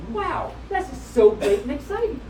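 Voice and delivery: ordinary delivery, nothing marked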